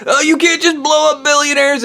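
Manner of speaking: high pleading whine; pleading caller. Retold or imitated. Mocking a caller pleading for billionaires, high whine. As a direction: beg frantically in a squeaky voice. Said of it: "was like".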